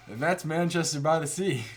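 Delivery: normal talking voice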